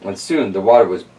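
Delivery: lively read-aloud storyteller voice; story narration. Acted. Host reading Stone Soup to kids, storytelling voice. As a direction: tell it like a bedtime picture book. Reads picture book aloud.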